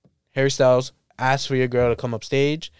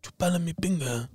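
[name]; 10 dB quieter than usual. Acted. Latin singer propositioning a woman on stage, soft impression. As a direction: murmur it softly as a Latin singer. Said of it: "That's how he talks, right?".

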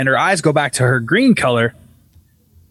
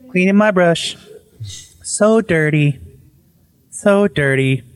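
eerie possessed-wife voice, drawn out; slow, nonchalant; 2 semitones higher, slower than usual. Retold or imitated. Possessed wife looking at the camera, slow and nonchalant. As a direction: draw it out slowly as if nothing's wrong. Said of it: "like, slowly ... like, nothing's wrong, nonchalant".

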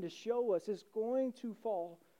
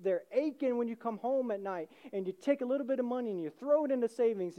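ordinary talk

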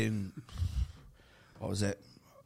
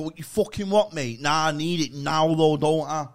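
higher, louder mock aggressive phone rant; fake angry phone call; 4 semitones higher, 7 dB louder than usual. Comedian acting hard past teenagers, fake-angry on the phone. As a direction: put on a hard man barking into a phone. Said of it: "pretend to have, like, an angry phone conversation".

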